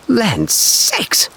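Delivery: high, scolding exclamation of a fussy aunt; startled old aunt. A narrator's reading. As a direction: cry it out like a flustered old aunt. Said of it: "she exclaimed".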